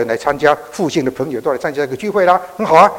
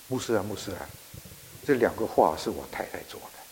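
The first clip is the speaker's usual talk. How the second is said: tearful; 6 semitones lower, 11 dB quieter than usual. Retold or imitated. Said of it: "哭了一大堆之后呢，才说".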